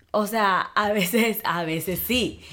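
ordinary talk

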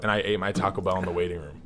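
ordinary talk

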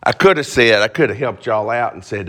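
usual speaking voice

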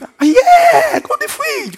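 very high squealed yes, loud then easing; mock-incredulous, soaring welcome; exuberant insistence. Preacher urging the shy guest to help herself, exuberant insistence.

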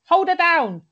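high, loud order; mock bark. Jenny ordering the pin-down, loud mock command. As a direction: snap out the command. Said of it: "She's just like".

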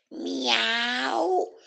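high, drawn-out meow; playful high cat meow. Acted. Cat greets the three.

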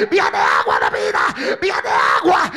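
sobbing cry of a preached line; crying shout. Acted. Pastor crying out to church while preaching. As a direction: cry out through tears.

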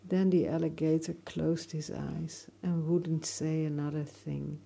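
ordinary delivery, nothing marked

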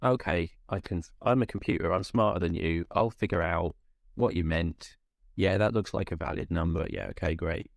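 mock a know-it-all computer; low, smug robot voice, lightly done. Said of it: "and then go".

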